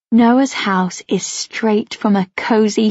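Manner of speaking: usual speaking voice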